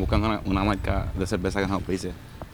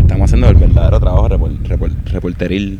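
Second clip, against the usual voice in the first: shouting over wind; 13 dB louder than usual. Real, not acted. Outdoors, mock reporter boast.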